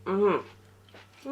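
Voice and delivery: ordinary delivery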